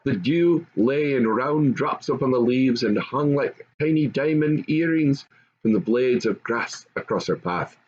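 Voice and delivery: usual speaking voice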